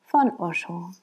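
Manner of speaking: ordinary delivery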